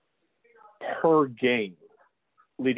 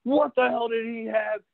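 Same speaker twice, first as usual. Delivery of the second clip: mock coach yelling, much higher and slower; imitating yelling coach; 9 semitones higher than usual. Referee imitating a coach yelling at his partner. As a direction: play the sideline coach hollering at the official. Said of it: "the coach is yelling".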